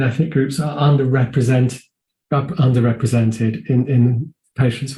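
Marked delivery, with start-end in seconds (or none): none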